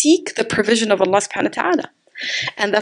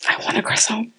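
half-whispered, softer wish for a croissant; quiet craving mumble; 5 dB quieter than usual. Retold or imitated. Preacher recalling her grumpy morning craving, a soft unvoiced mutter. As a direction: mumble it to yourself like a sulky craving.

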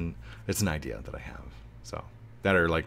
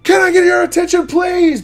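much higher, very loud call for attention; shout; 17 dB louder than usual. Pretend. YouTuber grabbing a mall crowd's attention, shouting. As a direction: bellow it across the mall. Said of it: "shouting out".

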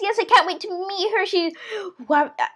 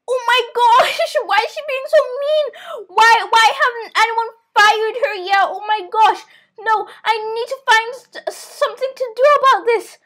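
higher, whiny indignation; upset disbelief; 6 semitones higher, 4 dB louder than usual. Pretend. Child left alone after babysitter's threat, dismayed protest. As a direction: complain like a wronged child.

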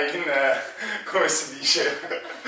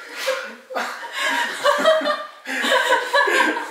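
high squealing laughter; shrieking laugh. Real, not acted. Laughing fit during partner balance.

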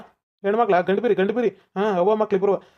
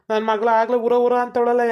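normal talking voice

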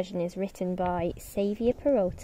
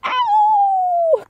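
very high loud yelp; wolf yelp of pain; 7 dB louder than usual. Acted. Wolf landing in the hot soup pot, yelping high. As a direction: yelp as if scalded. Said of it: "cried the wolf".

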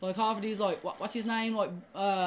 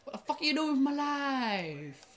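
exasperated outburst; 5 semitones higher than usual. Real, not acted. Dying in game, raging at it.

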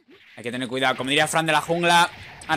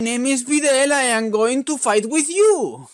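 high, slow, put-on anime girl in English; hammed-up fighter introducing herself; mock Videl voice; 8 semitones higher, 3 dB louder, slower than usual. Gamer voicing Videl in mock English, playful.

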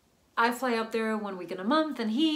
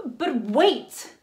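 loud, urgent plea to wait; loud, desperate interruption; urgent protest; 7 dB louder than usual. Acted. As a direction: call out to stop him hanging up. She stops him hanging up.

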